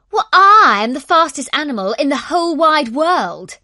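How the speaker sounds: loud, high boasting voice; the hare crowing he's the fastest; boastful hare. A narrator's reading. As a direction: brag at full volume like a cocky hare. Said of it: "said Noel".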